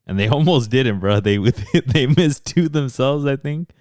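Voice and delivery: laughing through the words; chuckling while telling it. Real, not acted.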